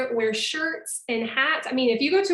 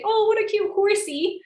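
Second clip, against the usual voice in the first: "horsey" cooed well above normal pitch; cute, high and sweet; 9 semitones higher, 4 dB louder than usual. Shown as a demonstration. Teacher showing the English diminutive with a cutesy coo. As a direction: gush over a tiny cute horse. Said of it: "we say".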